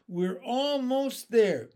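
high, slow, loud call; stretched excited shout; shouted encouragement. A narrator's reading. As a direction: spur them on to the finish. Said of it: "shouted the old green grasshopper".

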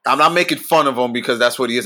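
normal talking voice

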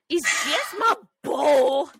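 hammed-up cry, stretching BOWL; mimicking shout. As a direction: belt it out slowly as a silly impression. Mimics what the line reminds her of.

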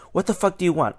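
retold yell. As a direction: act out an irritated challenge, not at full volume. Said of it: "and he starts yelling".

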